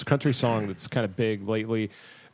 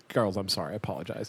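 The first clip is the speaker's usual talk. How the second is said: usual speaking voice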